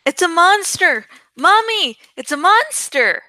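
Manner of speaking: put-on high, loud child's cry of fright; a little kid screaming for mommy; scared kid yelling. A narrator's reading. Small child yells to mother, high frightened shout. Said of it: "some little kid yelled".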